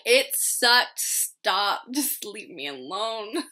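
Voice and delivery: loud, irritated brush-off that slows at the end; loud snappy leave-me-alone; fed-up outburst. Retold or imitated. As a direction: push everyone away, exasperated. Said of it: "and I'm like".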